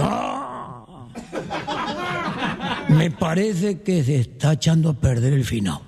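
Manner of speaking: a sniff then a slow, drawn-out worry; a gaucho smelling something off, slow and wary. Acted. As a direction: take in the smell and doubt it slowly.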